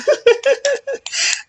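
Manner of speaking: high burst of laughter; a quick giggle at yourself. Real, not acted. Laughs at own joke.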